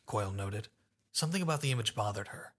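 usual speaking voice

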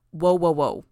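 loud, urgent repeated warning; alarmed. A narrator's reading. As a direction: hold her back with your voice. Gollum grabbing her wrist.

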